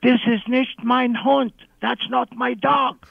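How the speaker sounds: high-pitched outraged woman, imitated; mock scream in a high voice, drawn out at the end; retold scream. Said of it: "In German, she screamed".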